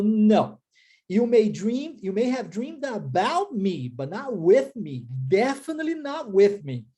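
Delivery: slow, firm, leaning on "with" in "not with me"; stressing the preposition. Retold or imitated. Friend Agnes correcting him, stressing "with" slowly. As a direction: press hard on "with", draw out "Definitely not with me". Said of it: "deu tanta ênfase à preposição".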